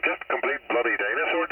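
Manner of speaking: normal talking voice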